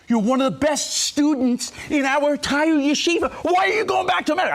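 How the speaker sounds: high, loud-edged appeal in disbelief; incredulous protest. Retold or imitated. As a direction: challenge the student, voice climbing sharply at the end. Teachers protest student's departure.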